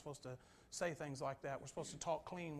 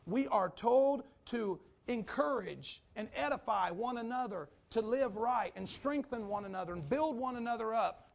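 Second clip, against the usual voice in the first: louder, stretched-out preaching on "told"; full-force preaching; 6 semitones higher, 8 dB louder than usual. Acted. Preacher listing what believers are told, rising full-force preaching. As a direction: drive each "We are told" home harder.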